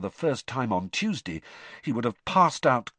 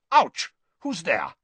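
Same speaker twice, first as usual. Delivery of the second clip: startled; 7 semitones higher, 5 dB louder, slower than usual. A narrator's reading. Karkaroff bumped in dark.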